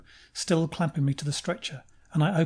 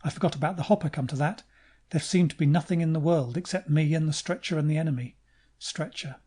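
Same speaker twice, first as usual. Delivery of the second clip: ordinary delivery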